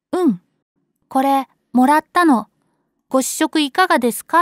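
put-on bouncy kid quoting the salesman; excited child. Child retells salesman's line.

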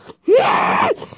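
high, harsh breathy screech; strained cry of exasperation; frustrated outburst. Acted. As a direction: let out a wordless angry shriek. Outburst at the height of anger.

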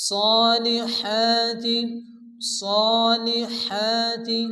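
সালিহাতি slow, each long a held one count; elongation demo. Shown as a demonstration. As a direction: stretch the vowels of সালিহাতি evenly. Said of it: "এক আলিফ পরিমাণ টান দিয়ে পড়তে হবে".

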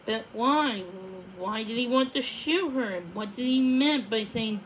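ordinary delivery, nothing marked

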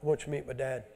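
soft, quick and tender; voicing Jesus. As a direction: gently invite them along. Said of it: "and he said".

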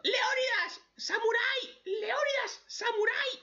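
very high, desperate screaming of names; protagonist screaming names. Retold or imitated. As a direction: shriek the names across a battlefield. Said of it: "el protagonista gritando".

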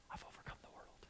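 hushed whisper of the promise. Acted. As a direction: breathe it, barely audible. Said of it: "whispered climax".

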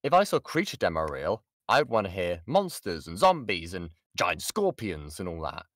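ordinary delivery

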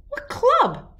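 high puzzled question; surprised. A narrator's reading. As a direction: ask it baffled. John puzzled by club rule.

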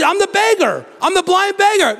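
high, eager insistence; insistent beggar. Retold or imitated.

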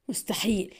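مستحيل muttered softly through rising anger; angry muttering. A narrator's reading. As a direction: grumble مستحيل under your breath in anger. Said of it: "مغمغما في غضب".

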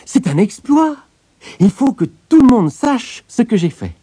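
high, loud boast to the world; excited exclamation. A narrator's reading. Tailor boasting of his exploit to himself, exclaiming excitedly. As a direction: proclaim your feat in a high, excited voice. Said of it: "s'exclama-t-il".

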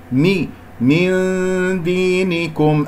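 "مي" ثم "من دينكم" ببطء مع إظهار الميم; اكسر الميم في "من دينكم" واقرأ ببطء; إظهار الميم مكسورة. Shown as a demonstration. (تلاوة بطيئة بكسر ميم "من")

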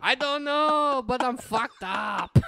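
put-on very high, slow comic Spanish accent. Drunk man confessing to his girlfriend, high mock Spanish accent. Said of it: "Why are you talking like that?".

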